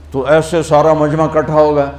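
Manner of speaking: ordinary talk, nothing marked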